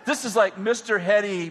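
ordinary delivery

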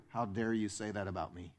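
soft, sulky, wounded mutter; offended huff. Retold or imitated. Churchgoer muttering offended at a friend. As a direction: sulk like you were wronged. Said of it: "we walk around and go".

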